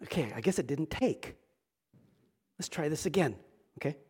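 low, very soft, as if to oneself; muted mock aside. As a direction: drop right down for an understated aside. Mock aside as Jesus retrying.

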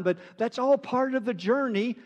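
usual speaking voice

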